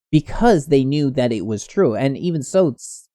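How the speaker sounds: normal talking voice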